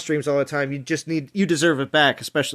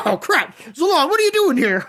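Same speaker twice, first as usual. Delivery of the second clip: imitating a startled friend, higher; mock surprise; 9 semitones higher than usual. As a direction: play caught off guard, high and quick. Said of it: "he's like".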